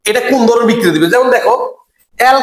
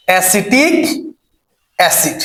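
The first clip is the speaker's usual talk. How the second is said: Acetic, a long pause, then acid lower; word-by-word dictation; 5 semitones lower, 3 dB quieter, slower than usual. Shown as a demonstration. Teacher drills the acid's name, acetic acid word by word. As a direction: split acetic acid into two separate words. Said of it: "কি acid?".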